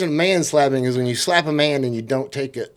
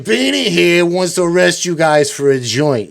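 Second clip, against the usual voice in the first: loud, higher-pitched mocking tease; ragging; 6 semitones higher, 6 dB louder than usual. Cops making fun of the new partner, loud ragging. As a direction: announce it mockingly to show up your partner. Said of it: "they were just ragging on him".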